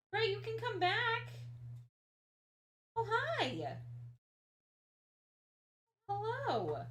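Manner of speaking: high sing-song voice for the cat; greeting her cat in a high pet voice. Real, not acted.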